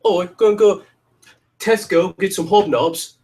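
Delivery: high, put-on British accent, slowing down; mock British accent. Shown as a demonstration. Teased by a friend, a high, clumsy British accent. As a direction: play up a hammy British accent. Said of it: "I can't do accents at all".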